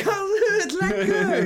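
giddy delight at meeting a fellow South African; excited laughing reply. Retold or imitated. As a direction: squeal it back through laughter. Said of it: "I'm like".

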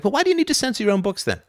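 high-pitched, quick challenge; incredulous retort. Retold or imitated. Lecturer answering Hume's claim about honesty, incredulous high retort. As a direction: fire back fast in a high, disbelieving voice.

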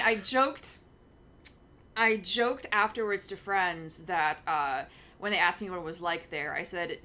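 usual speaking voice

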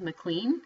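McLean said "muh-KLEEN", rising at the end; looked-up pronunciation. Shown as a demonstration. Reporting what the pronunciation guide said, McLean as muh-KLEEN. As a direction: say McLean to rhyme with "clean". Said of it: "that's what it said".

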